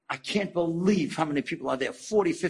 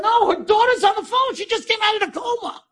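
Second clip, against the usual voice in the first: very high, urgent woman's reply; excited news, imitated; 4 dB louder than usual. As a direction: blurt out astonishing news in a high voice. Acting out woman's breathless news.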